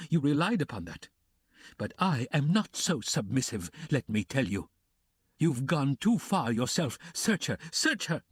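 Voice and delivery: high, rising frantic threats; a desperate woman defying the room, pitch climbing; Katerina in a frenzy. Acted. Katerina defying Luzhin, frantic and high.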